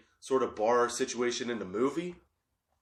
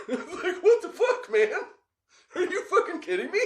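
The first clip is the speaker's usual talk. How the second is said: high, squeaky laughing incredulity; laughing what-the-fuck squeal; laughing disbelief. Retold or imitated. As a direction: cackle out the disbelief. Incredulous reaction, laughing through it.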